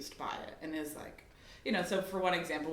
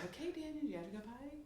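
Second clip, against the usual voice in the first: much higher, soft sing-song question; mom playing pretend; 7 semitones higher, 9 dB quieter than usual. Mom asking son in role play, high sweet voice. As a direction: coax like asking a toddler. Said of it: "and I say".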